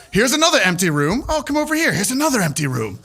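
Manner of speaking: breezy mock tour guide; super casual. Owner walks officer past empty rooms, put-on casual. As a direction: show them round as if nothing's up. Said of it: "about it, casual".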